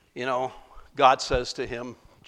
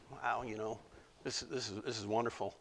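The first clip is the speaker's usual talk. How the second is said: much softer, relaxed; hushed contentment; 4 semitones lower, 14 dB quieter, faster than usual. Acted. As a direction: murmur in comfort. Jonah enjoying the shade.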